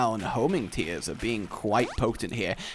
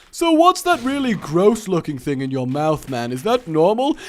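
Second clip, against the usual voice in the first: higher, louder acted questioning; tactless question; 5 semitones higher, 5 dB louder than usual. Asking Envy about the thing in his mouth, tactless. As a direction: pipe up with a blunt, nosy question.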